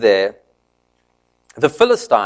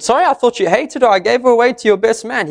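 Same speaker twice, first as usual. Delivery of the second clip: sheepish excuse; 9 semitones higher, faster than usual. Retold or imitated. Father-in-law excusing himself.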